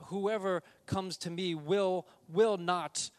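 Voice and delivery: loud, slow, leaning on will; slow thundering promise; emphatic. Acted. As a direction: preach it loud and slow, hammering will not. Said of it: "it's very emphatic here that Jesus says".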